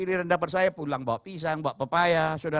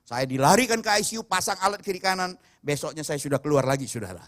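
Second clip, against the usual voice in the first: ordinary talk, nothing marked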